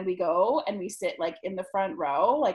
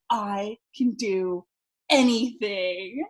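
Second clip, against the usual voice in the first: slow, louder proclamation; triumphant; 5 dB louder, much slower than usual. Retold or imitated. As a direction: savor every word like a champion. Feeling powerful after night.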